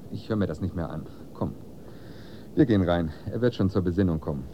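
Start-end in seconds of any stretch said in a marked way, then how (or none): none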